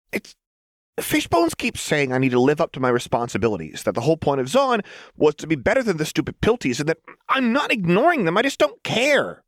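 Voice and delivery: high, fast, rising manic rant; agitated Jinx. A narrator's reading. Jinx explaining Fishbones' nagging to Lux, high rising rant.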